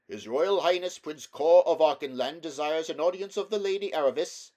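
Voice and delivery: high, loud ceremonial proclamation; herald's announcement. A narrator's reading. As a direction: proclaim it like a royal herald outside the gate. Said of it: "said the herald".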